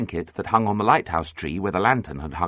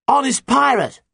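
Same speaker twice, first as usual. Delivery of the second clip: very high, loud pledge; shouted; 6 dB louder than usual. A narrator's reading. Pirate captain swears there is no trick, shouting. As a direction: yell it back emphatically. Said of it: "shouted Nancy".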